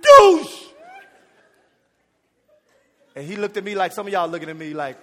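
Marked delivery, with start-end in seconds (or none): 0.0-0.6 loud imitated splash; mock splash noise; splash sound. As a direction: shout the splash of coffee. Throwing coffee, sound effect.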